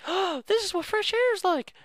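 put-on very high little girl voice, full of wonder; little sisters' voice. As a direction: marvel like a tiny child. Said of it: "where they were like".

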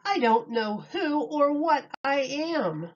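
lively picture-book read-aloud with big openings; reading to children. Acted. Miss Julie reading Dr. Seuss to children, animated storytime voice. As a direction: read it like storytime for little kids.

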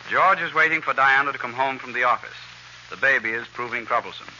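normal talking voice

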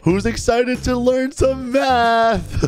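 high, slow rallying question; enthusiastic teacher. Acted. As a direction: hype up a class, stretching the words. Teacher hypes up the class.

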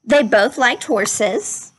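gentle, measured storybook read-aloud; unhurried classroom story reading voice; reading to children. Acted. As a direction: read it like a picture book to a class. Teacher reads picture book aloud.

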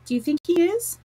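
high, eager question rising at the end; eager cry. A narrator's reading. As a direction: ask it eagerly, pitched well up. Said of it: "cried Mary eagerly".

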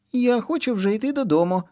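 put-on high child voice, whining; small girl wanting home; whiny child. A narrator's reading. Kitty whines to mom in high child voice. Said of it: "каже Киця Миця".

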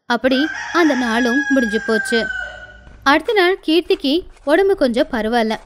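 usual speaking voice

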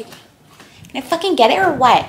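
loud, exasperated demand; impatient. Real, not acted. Vlogger fed up with the processing app, exasperated. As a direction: snap at the slow app.

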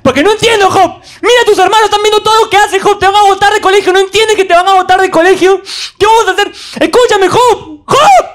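very high, loud frantic mom; desperate mother. Retold or imitated. Mother desperately scolding Job, very high and loud. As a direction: plead frantically as his mom.

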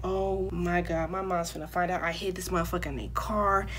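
low, hushed mock dread; retold dread. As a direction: dread it quietly, voice sinking. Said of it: "I'm scared shitless".

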